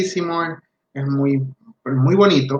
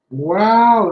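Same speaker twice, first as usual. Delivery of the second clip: put-on bright, higher, louder wow to hook the girl; excited wow; 6 semitones higher, 5 dB louder than usual. Therapist luring a tired girl, excited wow. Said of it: "Entonces yo le decía".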